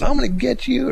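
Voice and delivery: high, loud mock threat; flustered threat. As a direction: imitate a flustered man yelling as he gives up. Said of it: "he was getting flustered".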